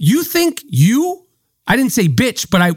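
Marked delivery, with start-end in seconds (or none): 0.0-1.3 slow, drawn-out "You think you" with a sneer; a scornful, slowed-down challenge; contemptuous tone. Retold or imitated. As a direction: let the contempt do the insulting. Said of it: "the inflection was bitch".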